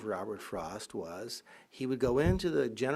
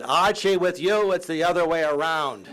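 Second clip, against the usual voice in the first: louder, higher quip; cutting retort; 3 semitones higher, 6 dB louder than usual. Retold or imitated. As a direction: fire back a sharp comeback. Frost's retort to MacLeish.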